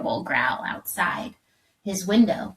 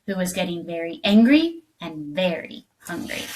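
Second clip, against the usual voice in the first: usual speaking voice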